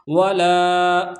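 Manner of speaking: ولا with the long a held very slowly; drawn-out reading. Shown as a demonstration. Teacher drawing out ولا so the students hear the madd. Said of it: "اس کو کھینچ کر پڑھیں گے".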